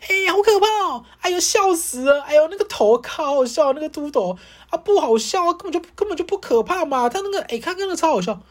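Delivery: high mocking girly chatter; mocking loud girls. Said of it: "他就想说".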